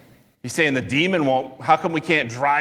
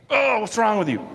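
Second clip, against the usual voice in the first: higher, exasperated mock outburst; retold exasperation, pitched up then falling; 7 semitones higher than usual. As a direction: act out a sudden exasperated reproach. Acting out Jesus's sudden outburst.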